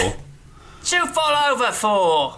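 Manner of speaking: very slow, high drawn-out reading; Jim Dale reading. Retold or imitated. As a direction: stretch each word out, high. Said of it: "that's how Jim Dale read it".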